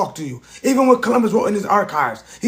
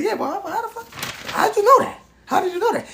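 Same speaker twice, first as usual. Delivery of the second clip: much higher, incredulous voice; shocked disbelief; 12 semitones higher, 4 dB quieter than usual. Retold or imitated. Man retelling asking doctor how he knew, much higher and incredulous. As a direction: squeak out the question in total disbelief.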